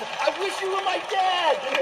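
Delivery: put-on very high gushing girl voice; mock fangirl gush. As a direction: squeal it like a starstruck teen. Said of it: "the one girl goes".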